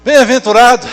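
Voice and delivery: loud, high proclamation; proclaiming loudly. Acted. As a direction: ring out the blessing. Preacher proclaims scripture word.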